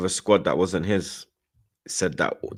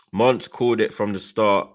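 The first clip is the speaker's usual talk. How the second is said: ordinary delivery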